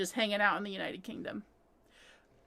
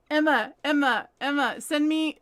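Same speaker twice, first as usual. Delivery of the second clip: ordinary talk, nothing marked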